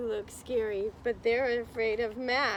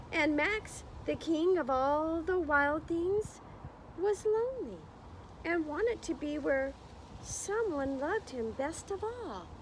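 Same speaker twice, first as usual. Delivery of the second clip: story narration; 6 semitones higher than usual. A narrator's reading. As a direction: read it like storytime, drawing out the words. Reading picture book to class.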